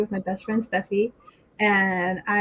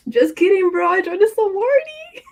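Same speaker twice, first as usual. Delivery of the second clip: much higher and louder, gleeful; excited prank reveal; 10 semitones higher, 6 dB louder than usual. Retold or imitated. Revealing a sorority prank to best friend, excited. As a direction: burst out with the gotcha, voice shooting up.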